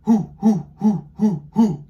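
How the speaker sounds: high, loud owl hoots; breathy owl hoo from the diaphragm. Shown as a demonstration. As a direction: hoot like Papa Owl on the breath. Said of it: "copy right after Papa Owl".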